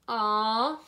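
drawn-out "aw"; disappointed groan. A narrator's reading. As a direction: groan like let-down children. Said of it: "groaned the children".

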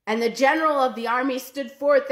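plain reading voice

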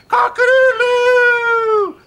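loud high slow Cock-a-doodle-doo; rooster crow. Acted. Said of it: "I'm Brewster the Rooster".

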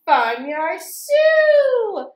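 slow, loud, high reveal; grand announcement. Acted. Reading to kids, slow loud reveal of Farmyard Sue. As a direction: draw the name out big.

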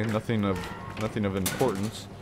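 normal talking voice

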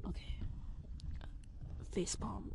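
whispered aside. Real, not acted. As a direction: whisper it to yourself. Embarrassed aside after mistake.

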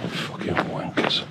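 whispered curse, slow and drawn out; muttered under breath. Real, not acted. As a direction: hiss the insult to yourself in disgust. Swearing at litter under breath.

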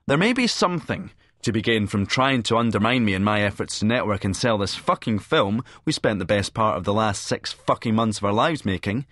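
plain reading voice

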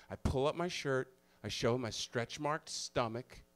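ordinary talk

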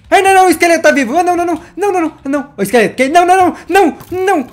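frantic gamer panic at a live skeleton; panicked yelps. Real, not acted. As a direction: squeal in panic as the skeleton comes back. Skeleton still alive, panic.

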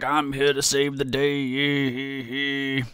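very slow, drawn-out heroic proclamation; mock hero voice. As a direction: announce it like a cartoon rescuer. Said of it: "I wish that was my voice".

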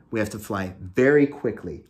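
ordinary delivery, nothing marked

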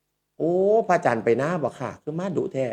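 เลียนสำเนียงอีสานช้าๆ แบบแม่บ้าน; Isan accent. Retold or imitated. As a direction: imitate a maid's Isan accent, slowly. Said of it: "สำเนียงอีสาน".

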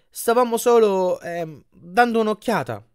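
high, slow hesitant cover-up; awkward, stalling apology; sheepish excuse. A narrator's reading. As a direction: fumble for an innocent excuse. Awkward excuse to a shop owner.

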